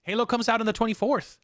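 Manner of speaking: ordinary talk, nothing marked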